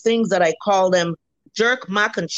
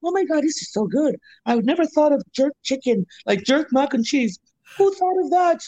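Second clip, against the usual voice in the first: put-on Canadian accent, pitched higher; 4 semitones higher than usual. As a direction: slip into a Canadian accent as the customer. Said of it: "is that your Canadian accent that you throw on there".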